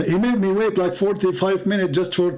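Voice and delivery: ordinary talk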